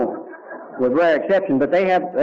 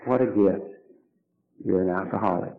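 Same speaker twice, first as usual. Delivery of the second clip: quiet awe; 9 semitones lower, 7 dB quieter than usual. Real, not acted. Repeating the revelation quietly.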